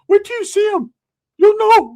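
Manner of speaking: put-on high, loud whimpering teen voice; crying cousin voice. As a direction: wail it like a scared kid cousin. Cousin Ricky answering.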